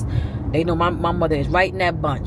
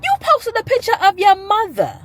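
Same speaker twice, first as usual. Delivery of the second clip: imitating aunt; 14 semitones higher, 3 dB louder than usual. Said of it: "She said".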